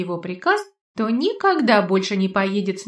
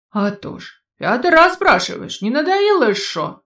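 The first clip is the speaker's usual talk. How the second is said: put-on old man voice; 4 semitones higher, 6 dB louder than usual. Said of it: "сиплый, старческий".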